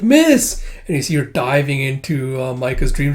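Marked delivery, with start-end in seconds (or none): 0.0-0.6 high, loud call; shouting a name. Retold or imitated. Kippa calling Miss into the dream, loud high shout. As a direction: yell her name to reach her. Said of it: "It's like".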